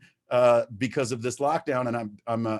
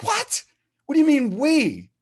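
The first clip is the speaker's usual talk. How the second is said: much higher, incredulous double take; astonished; 13 semitones higher, 5 dB louder than usual. Retold or imitated. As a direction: caught off guard by the word we. Retold stunned reaction to sponsor.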